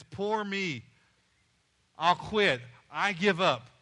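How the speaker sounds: loud, mock poor-me protest; mock moaning complaint. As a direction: act out giving up, loudly. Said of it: "when I am moaning about and complaining".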